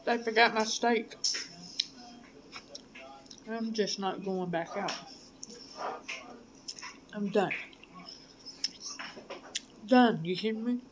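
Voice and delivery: talking between bites; chewing while talking. Real, not acted. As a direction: mouth partly full. Seen 1: Took bite at -7; closed-lip chewing between speech, fork held.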